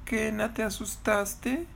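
slow, high, hushed odd little voice; strange voice. Acted. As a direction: call gently and slowly in an odd high voice. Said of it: "muy extraña". (voz extraña, aguda y lenta)

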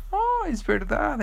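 very high, slow dawning surprise; slow realizing hen. Acted. Teacher hen remembering the father, in a very high slow voice. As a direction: stretch it out as it dawns on you.

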